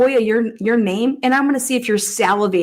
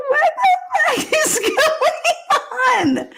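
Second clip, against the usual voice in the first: real laughter, slow and much higher; laughing shout; much slower than usual. Real, not acted. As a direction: shriek it out through helpless laughter. Helpless laughter at the clip.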